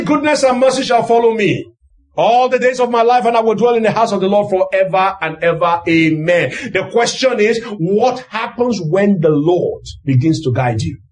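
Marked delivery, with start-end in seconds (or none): none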